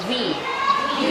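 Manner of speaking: V with buzzing lip-to-teeth friction; voiced V demo. Shown as a demonstration. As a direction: push air through a narrow gap on V. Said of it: "แล้วออกเสียงนะ แล้วบอกครูว่านักเรียนสังเกตเห็นอะไร". (สาธิตเสียง V ก้อง มีลมออกมา)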